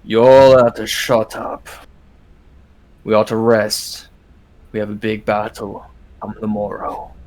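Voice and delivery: a weary elder telling them off; a dwarf telling the fighters to rest; gruff dwarf. Acted. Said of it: "another voice kind of chimes in".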